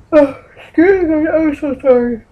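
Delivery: talking through a yawn. Real, not acted. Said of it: "And if you're yawning, I'm sorry".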